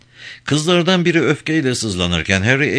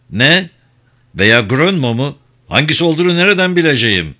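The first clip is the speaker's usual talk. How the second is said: louder, higher-pitched irritation; a touch of annoyed disbelief; exasperated; 5 semitones higher, 5 dB louder, faster than usual. A narrator's reading. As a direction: lose patience on the phone. Harry exasperated on the phone.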